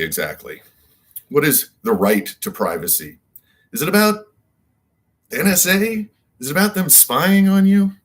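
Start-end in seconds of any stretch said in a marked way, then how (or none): 3.7-7.9 high, mock-puzzled questioning; pitched way up in disbelief; incredulous question. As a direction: ask it like the idea is absurd. Mock incredulous questions about privacy.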